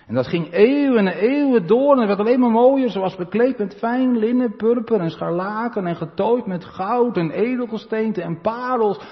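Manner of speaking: preaching at full force. Acted. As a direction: sweep them up in Babel's glory.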